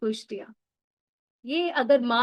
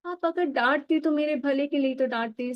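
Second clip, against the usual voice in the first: ordinary talk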